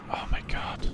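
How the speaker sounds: breathy whispered disbelief. Real, not acted. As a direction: whisper it in awe. Whispered in tense moment.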